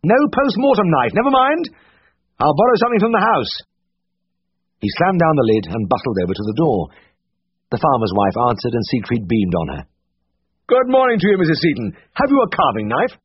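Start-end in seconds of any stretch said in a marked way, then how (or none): 0.0-3.5 higher brisk voice for Siegfried; Siegfried's voice, higher and brisk. A narrator's reading. Siegfried's voice held in reading. 10.7-13.2 higher brisk voice for Siegfried; Siegfried's voice, higher and brisk. A narrator's reading. Siegfried's voice held in reading.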